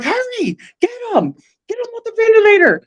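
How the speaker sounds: very high, fast, rushed orders; frantic urgency. Retold or imitated. Nurses racing to take him off the ventilator, frantic. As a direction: hurry them, pitch way up. Staff rushing to remove ventilator.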